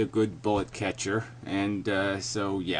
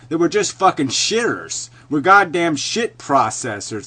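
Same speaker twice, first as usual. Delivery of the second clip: loud, higher-pitched, emphatic tirade; angry rant; 5 semitones higher, 6 dB louder than usual. Real, not acted. Railing at listeners about what humans are, angry rant. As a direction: hammer each curse with real force. Ranting that life is worthless.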